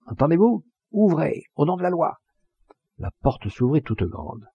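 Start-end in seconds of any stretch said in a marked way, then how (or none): 0.1-2.2 high, insistent official call; impatient gendarme calling out; shouted command. A narrator's reading. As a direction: demand entry through a closed door, pitched up. Gendarme again demands entry through door.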